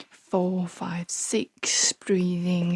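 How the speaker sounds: slow, hushed counting; slow whisper count. Shown as a demonstration. Mocking meditation counting, slow whisper.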